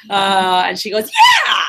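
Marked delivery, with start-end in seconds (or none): 1.1-1.6 a high, loud cry of agreement; wife shouting her agreement; excited shout. Retold or imitated.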